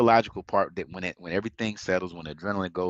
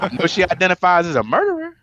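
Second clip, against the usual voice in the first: laughing; 11 semitones higher, 9 dB louder than usual. Real, not acted. Joke, both laughing.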